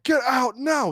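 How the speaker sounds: high, loud shout; furious order. Retold or imitated. Angry teacher ordering the student out, shouting. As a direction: bark him out of the class. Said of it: "he's like".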